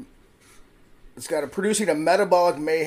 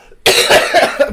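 sudden loud coughing fit; choking cough; 13 semitones higher, 13 dB louder than usual. Real, not acted. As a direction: cough on a swallowed drink. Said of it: "are you okay there?".

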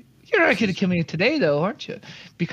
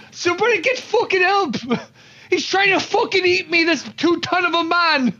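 panicked yelling; 8 semitones higher than usual. Acted. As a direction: scream for help while running. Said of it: "yelling this".

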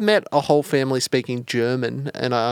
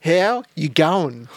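slow, higher, drawn-out greeting; loaded tone; 4 semitones higher, much slower than usual. Pretend. Testing the tone of a greeting, slow and loaded. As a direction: stretch the greeting out pointedly. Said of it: "Could be the tone".

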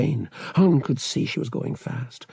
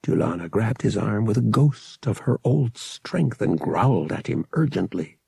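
ordinary delivery, nothing marked

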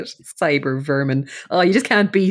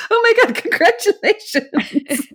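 very high, slow, laughing congratulations; mock gushing; slower than usual. Twins congratulate Winston on his wings, mock-gushing. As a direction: gush it, hammed-up and giggly.

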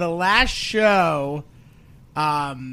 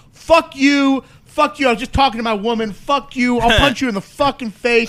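higher, louder mock yell; angry drunk yelling; 6 semitones higher, 4 dB louder than usual. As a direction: bark it like a drunk heckler. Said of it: "the one guy's like".

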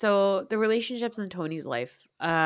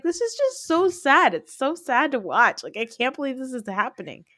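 sad; 7 semitones higher, 5 dB louder than usual. Retold or imitated. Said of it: "I was like".

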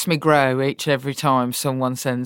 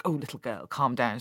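patronising dad; 2 semitones lower, 7 dB quieter than usual. Retold or imitated. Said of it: "talk to me like he was my dad".

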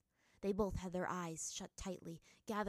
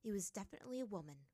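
plain reading voice

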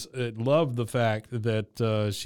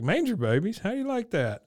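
much higher, proud and delighted; thrilled Hank; 8 semitones higher than usual. Retold or imitated. Retelling Hank delighted by the show name, rising high.

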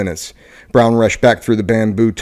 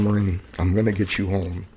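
soft, gentle reassurance, fading down; gently; 9 dB quieter than usual. A narrator's reading. Captain gently reassuring a burned Marine, soft and tender. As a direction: comfort a wounded man quietly. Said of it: "Brown said gently".

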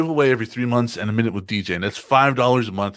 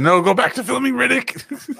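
high joking voice; 4 dB louder than usual. Acted. Jokey voice at Vin Diesel.

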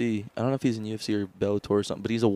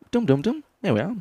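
high mock British chime; a hint of a British sat-nav; British GPS voice; 8 semitones higher than usual. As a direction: sing the chime then say it British. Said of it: "Instead of".

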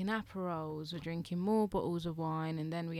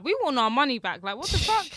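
raised, loud retold complaint; indignant; 6 semitones higher, 8 dB louder than usual. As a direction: demand it in mock outrage, rising at the end. Retold indignant demand for refund.

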